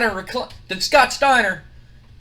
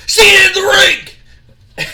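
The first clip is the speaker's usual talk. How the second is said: very high, loud shout; parting yell; 11 semitones higher, 9 dB louder than usual. Acted. Scott Steiner walking off after shoulder bump, loud parting yell. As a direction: yell it over your shoulder.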